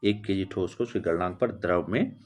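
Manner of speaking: normal talking voice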